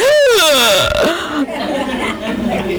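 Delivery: a sharp gasp, then laugh; gasping while spinning out. Retold or imitated. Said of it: "I was like".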